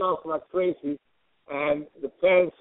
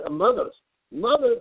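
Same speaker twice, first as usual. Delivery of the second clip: ordinary talk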